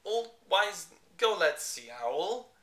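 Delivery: loud, slow jumbled words; scrambled speech. Acted. Brainless bear suggesting owl, loud scrambled. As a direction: blurt the words out of order. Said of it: "no one understood what he meant".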